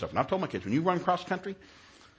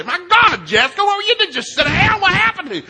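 high, loud exasperated scolding; hammed-up shouting of a fed-up dad; griping; 13 dB louder than usual. Dad griping at his daughter after the race, exasperated. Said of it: "I'm griping all the way back".

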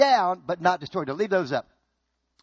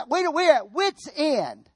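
high, drawn-out cry of exasperation; hammed-up helpless outburst; acted exasperation; 6 semitones higher, slower than usual. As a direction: stretch it out high, at the end of your rope. Acts being out of options.

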